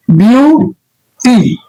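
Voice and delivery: ब्यूटी drawn out slowly as biu-ti; careful pronunciation. Shown as a demonstration. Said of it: "pronunciation कीजिएगा तो कैसे होगा".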